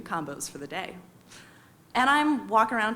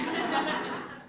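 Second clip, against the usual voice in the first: a burst of real laughter, higher; 8 semitones higher than usual. Real, not acted. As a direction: laugh at your own punchline. Laugh after her own punchline.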